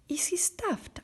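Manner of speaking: high, breathy surprised question. A narrator's reading. Lion asking Dorothy about the Scarecrow, surprised. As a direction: ask in sudden wonder. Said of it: "asked the lion in a surprise".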